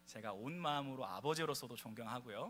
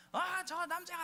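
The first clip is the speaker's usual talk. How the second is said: imitating a frail mother crying out, much higher; mock shriek at the man, pitched way up; retold yelling; 4 dB louder than usual. Pastor voices the dying mother yelling at the caregiver, high mock shriek. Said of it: "막 소리를 지르다가".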